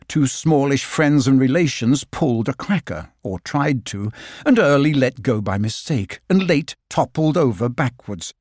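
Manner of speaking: usual speaking voice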